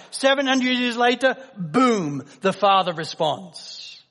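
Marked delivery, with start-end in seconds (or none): none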